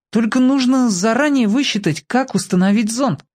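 put-on high-pitched boy explaining his idea; eager young boy. A narrator's reading. Boy telling the girl about his planetarium, earnestly. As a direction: lift the voice high like a child inventor.

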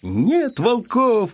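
high, long-drawn cheer; shouting. A narrator's reading. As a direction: chant it high and slow. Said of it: "кричал ёжик". (протяжный высокий выкрик)

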